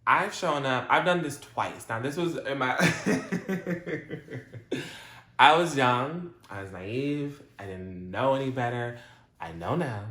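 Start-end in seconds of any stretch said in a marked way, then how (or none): 2.8-5.3 real high-pitched burst of laughter. Real, not acted. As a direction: break up laughing at yourself. Breaks up over own confession.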